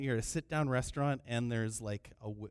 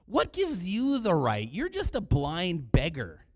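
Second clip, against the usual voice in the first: loud, emphatic rebuke; indignant scolding; 2 semitones higher, 7 dB louder than usual. Acted. Crowd rebukes the blind beggar, indignant scolding. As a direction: put him in his place, leaning on words.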